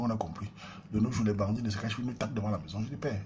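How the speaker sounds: usual speaking voice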